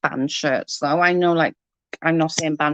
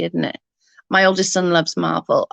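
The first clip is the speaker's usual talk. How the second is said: ordinary delivery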